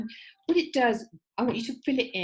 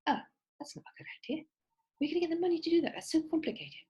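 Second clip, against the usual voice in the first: breathy, mocking, higher put-down; dismissive friend; 8 semitones higher, 4 dB quieter, much faster than usual. Friend mocks the student's big project, scoffing breathily. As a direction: shoot the idea down, scoffing. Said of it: "So you tell someone and go".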